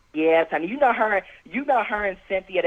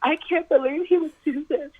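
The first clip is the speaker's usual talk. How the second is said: mock fake crying; 7 semitones higher than usual. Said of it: "She fake crying".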